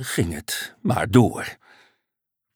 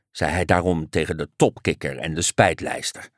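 ordinary delivery

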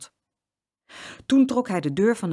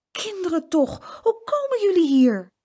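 astonished concern; 13 semitones higher, 6 dB louder than usual. A narrator's reading. Uncle finding the tearful twins in his car, astonished. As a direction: exclaim in dismay, stretching the words.

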